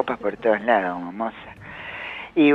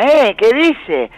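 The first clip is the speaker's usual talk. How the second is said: Santoro; 8 semitones higher, 3 dB louder, much slower than usual. Retold or imitated. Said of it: "Santoro se agarró la cabeza".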